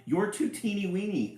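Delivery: mocking prince. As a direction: sneer down at the little one. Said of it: "said Prince Butterscotch".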